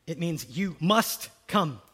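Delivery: ordinary talk, nothing marked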